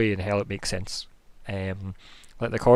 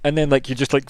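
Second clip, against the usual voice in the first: ordinary talk, nothing marked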